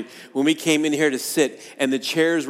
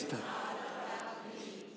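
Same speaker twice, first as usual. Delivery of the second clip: soft breathy repeat; 18 dB quieter, much slower than usual. Shown as a demonstration. Pastor leads the church in a whispered final repeat, slow and soft. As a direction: whisper it slowly, almost no voice. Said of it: "One more time".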